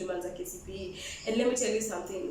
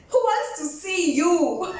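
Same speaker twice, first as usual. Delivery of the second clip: high, louder, slow comeback; sassy retort; 6 semitones higher, 6 dB louder, slower than usual. Retold or imitated. Woman snapping back at a man who dumped her, scornful. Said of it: "I'm like".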